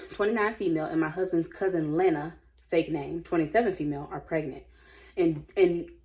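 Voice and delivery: usual speaking voice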